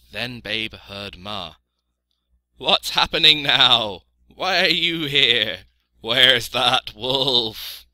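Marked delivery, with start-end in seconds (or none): 2.6-7.9 loud, higher sheep voice with drawn-out words; bleating sheep, demanding. A narrator's reading. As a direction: demand answers like an alarmed sheep.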